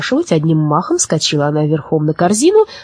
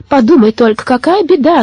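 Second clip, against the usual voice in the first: put-on high, louder lamenting old women; wailing lament; 8 semitones higher, 4 dB louder than usual. Old women complaining to Baba Yaga about their disaster, wailing lament. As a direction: lament the calamity in a high, rising voice. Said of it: "запричитали старушки".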